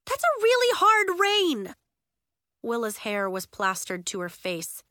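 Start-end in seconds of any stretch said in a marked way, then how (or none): 0.1-1.7 high, loud alarm; urgent high-pitched complaint; crying out. A narrator's reading. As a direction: shout over a downpour. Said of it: "Nori cried".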